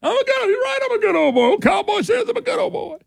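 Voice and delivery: cartoonish good-ol'-boy bragging; good old boy. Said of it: "It sound a little different than that kind of candidate".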